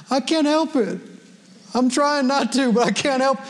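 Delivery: mock plaintive protest, pitched high; helpless excuse. Believer making excuses to the verses, mock helplessness. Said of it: "the appropriate response to these verses is not".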